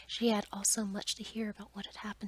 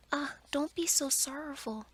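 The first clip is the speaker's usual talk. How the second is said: higher-pitched pleading exclamation; cried plea; 6 semitones higher, 4 dB louder than usual. A narrator's reading. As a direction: cry it out, pitched well up, to console. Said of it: "cried Beauty".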